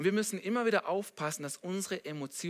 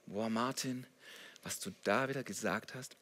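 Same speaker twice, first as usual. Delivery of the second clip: reproach; 6 semitones lower, 5 dB quieter than usual. Acted. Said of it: "Er steht nicht neben uns und sagt".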